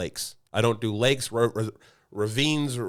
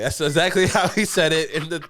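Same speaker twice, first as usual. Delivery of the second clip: laughing; 6 semitones higher, 5 dB louder than usual. Real, not acted. Laughing recalling friend's phrase.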